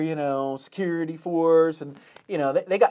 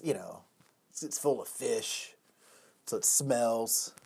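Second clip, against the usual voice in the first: usual speaking voice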